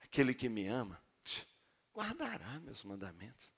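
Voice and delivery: very soft, low, tender voice; gentle loving reading. Shown as a demonstration. Preacher voices the Holy Spirit speaking the text to him, softly tender. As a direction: speak it as a quiet loving assurance. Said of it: "como que o Espírito Santo fala esse texto pra mim? Totalmente diferente".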